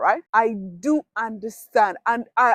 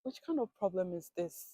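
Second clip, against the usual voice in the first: quiet disbelief; 3 semitones lower, 11 dB quieter than usual. Retold or imitated. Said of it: "I was like".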